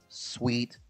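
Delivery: ordinary delivery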